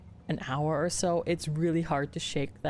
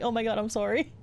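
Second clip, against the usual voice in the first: embarrassed, higher-pitched sorry; retold apology; 6 semitones higher, faster than usual. Apologizing to the Thai lady, embarrassed. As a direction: apologize in a fluster, lightly re-enacted. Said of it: "I'm like".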